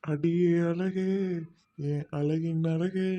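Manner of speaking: slow, hammed-up romantic verse; mock poetic lover. Boyfriend serenades his girlfriend, slow hammed-up poetic voice. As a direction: croon it like a cheesy film hero. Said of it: "கூப்பிடாதடி, பார்க்க சகிக்கல".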